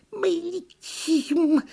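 thin drawn-out turtle peep; squeaking turtle. A narrator's reading. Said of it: "пискнула черепаха".

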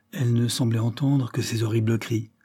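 ordinary delivery

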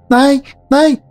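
high, insistent spirit demanding; greedy spirit voice. Acted. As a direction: beg greedily like a hungry spirit. (жадный голос духа)

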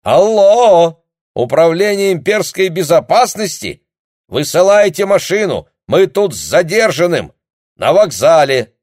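loud, high shouting into the receiver; shouting on the phone. Acted. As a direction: bellow it down a bad line. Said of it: "обрадованно заорал капитан".

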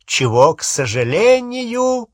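high, slow and pointed. A narrator's reading. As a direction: lift the voice pointedly, drawing it out. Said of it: "повысив голос".

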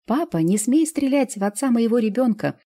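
high, loud plea; shouting. A narrator's reading. Daughter shouts at father not to shoot her child's father, high and loud. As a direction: scream at him not to shoot. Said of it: "кричала малышка".

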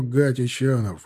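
ordinary delivery

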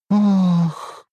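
high breathy moan of pleasure; elf moan. Acted. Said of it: "простонала эльфийка".

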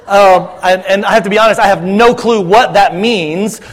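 normal talking voice

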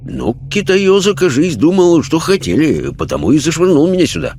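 bemused sailor. Acted. Sailor spirit explaining to sitters why he came, bemused. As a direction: reason it out aloud like a puzzled deckhand.

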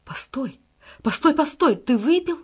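hushed first word bursting into high, loud cries; horrified cry. A narrator's reading. Salieri sees Mozart drink the poison, horrified cry. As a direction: cry out in horror, leaping up in pitch and volume. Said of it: "Сальери в ужасе кричит".

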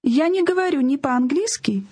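ordinary talk

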